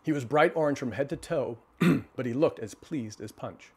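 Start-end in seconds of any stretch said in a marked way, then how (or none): none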